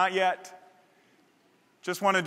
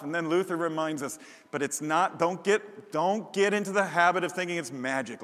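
ordinary talk, nothing marked

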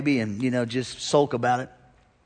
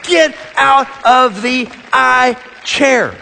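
exhorting shout; 8 semitones higher, 7 dB louder, much slower than usual. Acted. Climactic exhorting line.

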